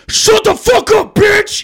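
angry yell from the back. Retold or imitated. Annoyed moviegoer yelling at a kid, furious. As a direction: bark it across the dark theater. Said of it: "you hear somebody who's obviously older go".

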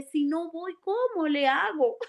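a hint of a crying prayer, held back; tearful plea. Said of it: "yo solo lloraba por esa alma y decía". (súplica llorosa, suave)

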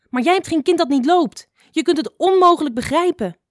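high, loud, upset outburst; shouting back. A narrator's reading. Mother boar shouts back at the bird about her child, heated yell. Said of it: "schreeuwde ze terug naar hem".

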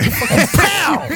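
drawn-out high mock impact sound; mock pow. Shown as a demonstration. GM voicing the orb impact, drawn-out pow. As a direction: stretch out a cartoon pow.

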